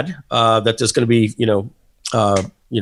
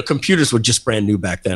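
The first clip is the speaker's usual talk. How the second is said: ordinary talk, nothing marked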